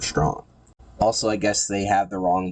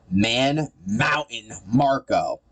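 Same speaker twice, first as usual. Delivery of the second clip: slow, higher, drawn-out villain announcement; mock-grand introduction; 4 semitones higher, much slower than usual. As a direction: stretch each word with sarcastic fanfare.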